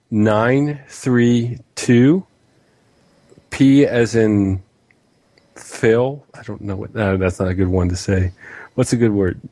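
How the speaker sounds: slow letter-by-letter dictation; spelling it out. Shown as a demonstration. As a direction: read out each character clearly, rising on each. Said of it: "so I hope you have a pen and pen, paper ready".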